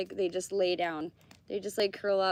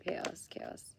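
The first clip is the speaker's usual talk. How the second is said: whisper; 3 semitones lower, 9 dB quieter than usual. Real, not acted. Repeats the word, then whispers it.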